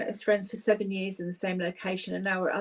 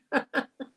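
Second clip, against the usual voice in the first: laughter burst; 6 semitones higher than usual. Real, not acted. Laughter exercise.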